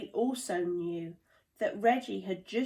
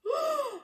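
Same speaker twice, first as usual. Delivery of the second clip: sharp gasp. A narrator's reading. Reggie sees the problem.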